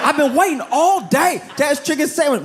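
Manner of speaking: high, fast, shrill-pitched mock shouting; angry sandwich customer. Comedian mocks customer who waited hours, high mock yelling. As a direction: squeal it like a customer losing his mind in line.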